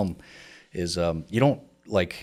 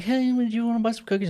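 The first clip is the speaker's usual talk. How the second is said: put-on high, sweet little girl voice; imitating a little Girl Scout. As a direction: mimic a small girl asking sweetly.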